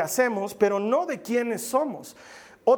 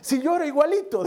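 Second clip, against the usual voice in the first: much higher, incredulous question through a laugh; baffled surprise; 6 semitones higher than usual. Retold or imitated. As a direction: ask in mock disbelief, voice jumping up. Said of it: "Yo digo". (sorpresa incrédula, voz mucho más aguda y entre risas)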